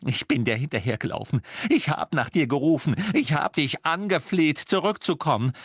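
wounded, angry tirade; hurt accusation. A narrator's reading. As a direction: heap up the reproaches. Hermine's tirade at Ron.

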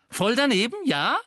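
high, loud echo flung back; indignant cry. A narrator's reading. Seamus echoing Ron's reproof, shouting indignantly. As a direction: throw the phrase back as a challenge. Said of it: "rief Seamus".

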